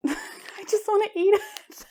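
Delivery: high laughing repeat; giggly joke. Real, not acted. Joking the filament looks edible, giggling. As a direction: giggle as you say it again.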